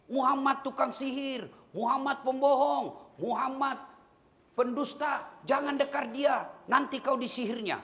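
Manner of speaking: shouting blind man. Retold or imitated. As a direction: cry it out across the market. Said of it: "Itu aja kerjanya".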